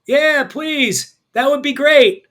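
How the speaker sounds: calling back from far off; crying out. A narrator's reading. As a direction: call out loud and eager. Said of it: "he hears a voice cry out".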